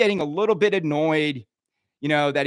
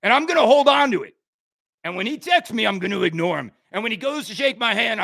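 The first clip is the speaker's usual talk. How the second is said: ordinary delivery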